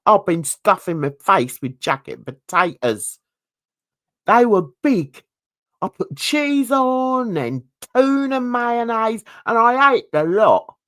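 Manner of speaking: broad London accent, rereading the quote. Shown as a demonstration. Vlogger rereads [name]'s quote in London accent. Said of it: "I can actually say it in his accent".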